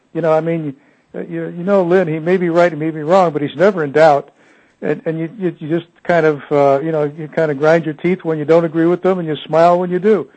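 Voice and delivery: amused, with a smile in the voice; smiling voice. Real, not acted. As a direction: say it grinning, fondly teasing. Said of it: "I say that with a smile on my voice".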